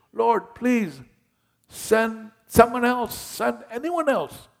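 high, loud pleading; begging Moses. Retold or imitated. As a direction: beg to get out of it, high voice. Moses begging, voiced by preacher.

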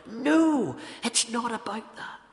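insistent, high-pitched denial; emphatic rebuttal. Acted. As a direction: wave away the objection with a big no. Preacher answers voiced objection.

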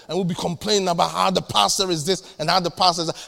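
ordinary talk

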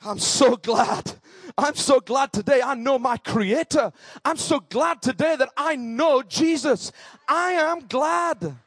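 emphatic, jubilant testimony; excited preaching. Real, not acted. Preacher testifying that they know their creator, excitedly. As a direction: build to a slow, high declaration of joy. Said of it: "Sorry, I'm getting excited".

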